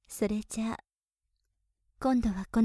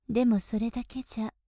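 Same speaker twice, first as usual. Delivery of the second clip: normal talking voice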